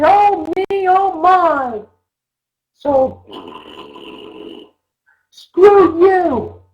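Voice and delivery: put-on high, whiny sulky kid; little child voice. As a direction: whine it like a sulking little child. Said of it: "the little child that says".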